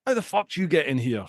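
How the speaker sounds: ordinary delivery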